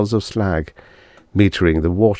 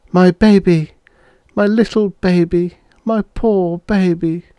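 high, sad murmur, slowing at the end; 12 semitones higher than usual. A narrator's reading. Mother grieving her baby, murmuring over and over. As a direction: croon over a lost baby. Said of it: "his wife murmured over and over".

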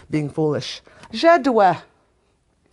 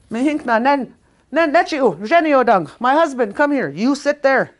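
higher and louder, hammed-up bossy order; mock bossy wife; 9 semitones higher, 7 dB louder than usual. Wife playfully ordering her husband to sit, loud and mock-bossy. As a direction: boss the husband around in fun.

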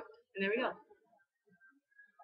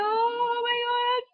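real panicked squeal, much higher and slower; frightened gamer shrieking at the screen; scared; 12 semitones higher, 5 dB louder, much slower than usual. Real, not acted. Frightened gamer panicking at a scary game section, high scared squeal. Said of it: "I'm scared".

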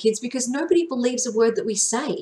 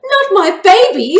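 much higher, much louder doting cry; protective mother; 12 semitones higher, 12 dB louder than usual. A narrator's reading. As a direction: clutch your child and refuse. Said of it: "hugging the youngest brother squad tightly".

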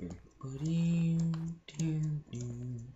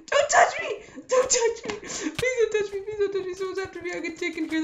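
laughing shriek; 9 dB louder than usual. Real, not acted. Chased, laughing while screaming.

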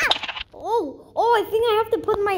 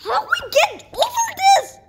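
high, raised exasperated wail; exasperated cry; 12 semitones higher, 4 dB louder than usual. Real, not acted. Player stuck at an obstacle, exasperated cry.

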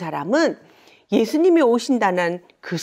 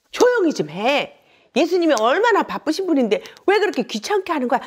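quick, raised-pitch telling-off; scolding; 7 semitones higher, 4 dB louder than usual. Acted. Crowd scolding the noisy blind men, quick annoyed rebuke. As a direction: hush them with an impatient rebuke. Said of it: "사람들이 그들을 꾸짖었어요".

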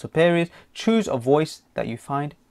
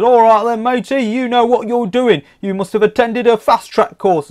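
loud, higher Australian officer voice; mock Australian cop; 8 semitones higher, 6 dB louder than usual. Officer praising the driver, hammed-up Australian accent. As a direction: play the cop, cheerful and Aussie. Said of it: "He says".

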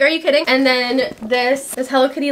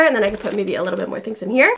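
normal talking voice

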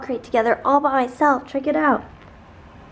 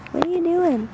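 puppy talk; 8 semitones higher than usual. Real, not acted. Cooing at puppy.

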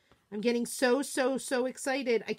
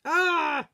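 dismayed cry; 5 semitones higher, 8 dB louder than usual. Real, not acted. Smudges the paint.

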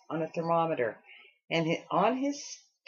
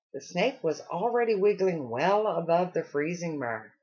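usual speaking voice

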